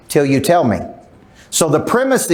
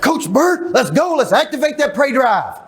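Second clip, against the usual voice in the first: much higher, hyped cheer; mock gym fans; 8 semitones higher, slower than usual. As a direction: pump him up like an eager fan. Gym people greeting him eagerly.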